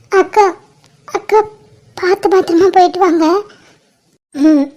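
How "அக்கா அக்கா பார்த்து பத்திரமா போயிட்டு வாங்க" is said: higher, teary voice calling her sister; tearful pleading. Acted. Younger sister tearfully sending her sister off, higher crying voice. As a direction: choke back tears while saying goodbye. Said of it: "என்ன மலரு அழுதுட்டு இருக்க இப்படி?".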